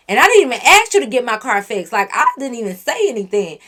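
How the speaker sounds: ordinary delivery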